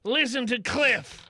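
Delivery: shouting announcement. Acted. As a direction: preach calm to the passengers. Addressing crowd on train.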